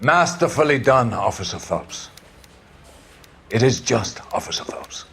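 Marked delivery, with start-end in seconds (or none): 4.3-5.1 whisper. Acted. Captain quietly confides approval to Phelps.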